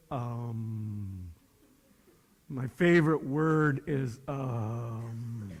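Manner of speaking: low, soft, drawn-out stalling; hesitant kid. Retold or imitated. Young person asked for a favorite word, mock hesitant. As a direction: imitate someone stuck for an answer, dragging out the um.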